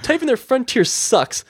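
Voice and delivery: high, slow, emphatic dictation; mock defiance. Customer ordering agent to note complaint, mock defiant. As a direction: dictate it slowly in mock indignation.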